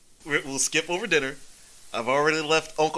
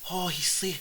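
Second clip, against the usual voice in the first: ordinary talk, nothing marked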